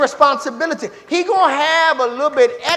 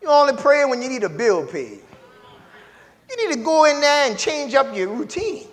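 ordinary delivery

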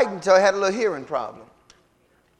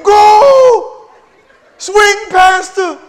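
high, loud stadium shout; cheering fan; 13 semitones higher, 14 dB louder, much slower than usual. Retold or imitated. Fan cheering the pastor at a softball game, loud shouts. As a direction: yell like a fan in the stands. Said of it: "at the softball game".

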